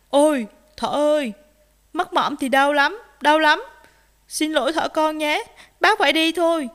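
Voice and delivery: loud, alarmed excuse; frightened bear. A narrator's reading. Bear apologises to rabbit and leaves, frightened. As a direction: back away in fright. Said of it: "bác gấu sợ hãi bỏ đi".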